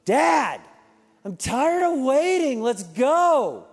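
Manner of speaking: high, loud whining yell, slowed; impatient child yelling. Retold or imitated. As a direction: stamp your foot and yell it like a boy. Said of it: "I hear him stomping his foot".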